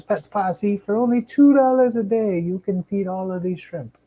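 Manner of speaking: high-pitched TV appeal voice, swelling on the price; mock sales pitch. Streamer playfully asking viewers to fund shrimp, mock sales pitch. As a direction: pitch it like a hammed-up charity ad.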